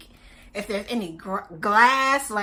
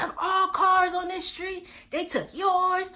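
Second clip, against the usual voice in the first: mock livid boyfriend; 7 semitones higher, slower than usual. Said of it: "He is livid".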